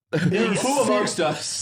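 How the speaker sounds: slow, high, grandly drawn-out question; mock solemn. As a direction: ask it like a lofty sage.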